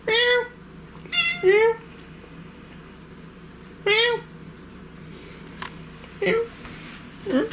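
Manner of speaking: very high imitated meow; cat meow. Owner meowing back to the cat, very high.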